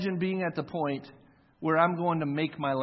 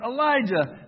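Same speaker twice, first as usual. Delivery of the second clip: surprised call; 7 semitones higher, 4 dB louder, slower than usual. Retold or imitated. Obadiah startled to meet Elijah.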